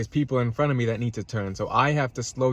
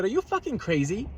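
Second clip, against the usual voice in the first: retold disbelief; 9 semitones higher than usual. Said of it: "I said".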